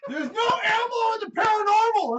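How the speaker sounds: loud laughing shout. Real, not acted. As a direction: yell it over the laughter. Host yelling to stop the bit.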